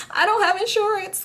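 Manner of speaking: very high, squeaky confession; panicked blurting. Retold or imitated. Driver to the pregnant woman, panicked blurt. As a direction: let it slip out high and helpless.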